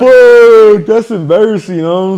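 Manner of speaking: loud, high, drawn-out exclamation; real embarrassed outburst. Real, not acted. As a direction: cry out in mortified disbelief. Reacting to videos of the sting.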